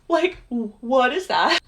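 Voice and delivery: retold spooked disbelief; alarmed question. Retold fright at following figure.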